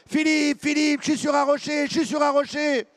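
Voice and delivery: high, loud alarmed calls; Jacques shouting. Retold or imitated. Teammate on the rock warning the swimmer, loud. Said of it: "Jacques qui crie".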